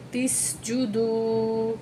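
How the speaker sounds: تِس جُدُو slowly, with a crisp s sound; clear sin. Shown as a demonstration. Teacher shows students the sin sound. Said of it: "سین کی آواز نکالنی ہے، سا نہیں پڑھنا".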